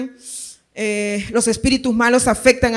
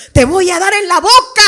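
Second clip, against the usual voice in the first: high, raised-voice mock threat; yelling mother; 6 semitones higher, 4 dB louder than usual. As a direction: imitate an angry mom threatening with the ladle. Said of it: "decía así mi mamá". (madre regañona, amenaza a gritos)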